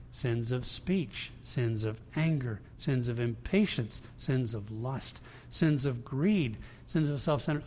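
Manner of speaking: slow, each sin spaced out; measured, slow litany; slow deliberate list. Real, not acted. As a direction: lay them down one by one slowly.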